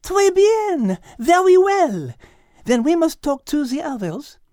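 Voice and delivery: a touch of French charm in every word; French lady. Acted. Liz agreeing with Max in a light French accent.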